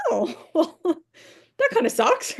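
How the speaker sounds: high, laughing, slowed-down shrug of a let-down; wry high-pitched oh well; mock disappointment. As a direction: brush it off with a squeaky laugh. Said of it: "I was like".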